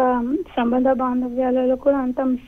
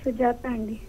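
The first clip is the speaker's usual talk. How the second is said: softer, timid answer; speaking softly; 9 dB quieter than usual. Real, not acted. Caller answering the host's assistant: soft and timid. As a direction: answer barely above a murmur. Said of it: "ఎందుకు అంత చిన్నగా మాట్లాడావు".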